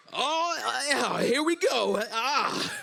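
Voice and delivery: high, slow wobbling effort; hammed-up strain, drawn-out and high; struggling in heels. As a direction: act out tottering on high heels. Acts struggling to walk in heels.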